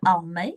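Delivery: "Almi" with a final "ee" sound, rising; tentative pronunciation. Shown as a demonstration. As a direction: try "Almi" as AL-mee, questioning. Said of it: "don't know how to pronounce it".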